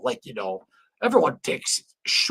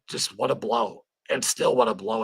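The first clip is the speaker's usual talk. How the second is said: ordinary talk, nothing marked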